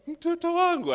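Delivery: loud high call to a passer-by; old man calling out. Retold or imitated.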